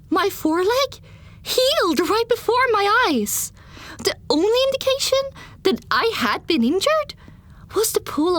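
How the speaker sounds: high-pitched, breathless dramatic telling; astonished narration. Acted. Pony narrator sees her foreleg heal, astonished high narration. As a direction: lift the pitch sharply in amazement. Excited peak in story narration.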